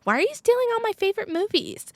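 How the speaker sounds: high, fast mock whine; rapid high-pitched sibling protest; indignant sister. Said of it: "she said".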